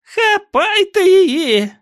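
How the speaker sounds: very high, slow witch's screech; Rhea screeching. A narrator's reading. Witch urging the crowd to seize the girl, cackling screech. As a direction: shriek the order like a crone. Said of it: "заверещала Рея".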